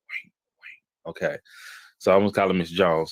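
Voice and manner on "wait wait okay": breathy whispered wait, stopping herself; whispered aside. Real, not acted. Host interrupts her own story, whispered. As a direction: hush it out as a quick aside to herself.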